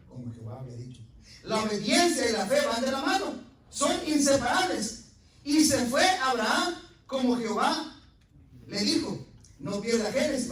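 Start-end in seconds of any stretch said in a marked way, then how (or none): 1.4-10.5 loud, high preaching proclamation; full-force preaching. Acted.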